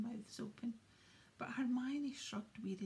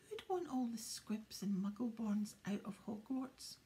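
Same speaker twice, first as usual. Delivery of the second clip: plain reading voice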